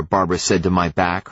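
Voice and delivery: ordinary delivery, nothing marked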